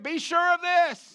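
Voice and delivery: high, drawn-out rallying shout; loud enough for neighbors. Shown as a demonstration. Preacher urging the congregation so neighbors hear it, loud shout. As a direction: belt it out slowly for the next house to hear. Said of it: "let our neighbors hear it".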